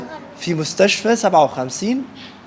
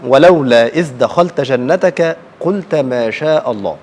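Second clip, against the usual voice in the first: measured Quran recitation; 3 semitones lower, slower than usual. Acted. Teacher recites the Quran verse.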